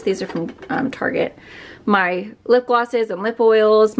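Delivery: usual speaking voice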